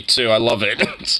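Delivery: usual speaking voice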